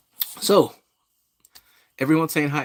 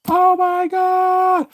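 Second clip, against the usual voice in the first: drawn-out, high, loud oh my God; awed exclaim; 8 dB louder, much slower than usual. Real, not acted. Vlogger starstruck as Pacquiao appears, high and drawn out. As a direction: gasp it out in awe.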